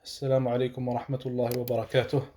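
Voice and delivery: low, fast, hushed recitation; quick, subdued religious preamble; opening invocation. Real, not acted. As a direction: rattle through the formal Arabic opening in a low monotone.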